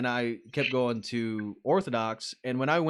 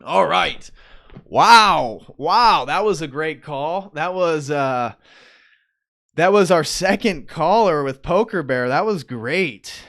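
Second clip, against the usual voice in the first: excited; 3 semitones higher, 9 dB louder, slower than usual. Real, not acted. Excited host after great call.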